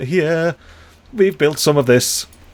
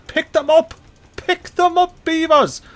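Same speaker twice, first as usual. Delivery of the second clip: urgent plea; 12 semitones higher than usual. Real, not acted. Urging the beavers.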